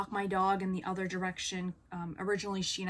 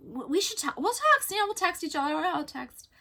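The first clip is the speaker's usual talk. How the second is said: much higher, flustered hammed-up voice; mock her stumbling over a promise to text; mocking imitation; 11 semitones higher, 3 dB louder than usual. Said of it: "And she's like".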